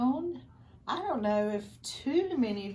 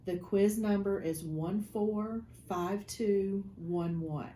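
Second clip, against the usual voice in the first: ordinary delivery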